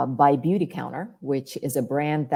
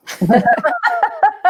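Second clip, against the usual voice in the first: bright laugh; 8 dB louder than usual. Real, not acted. Laughs at own repetition.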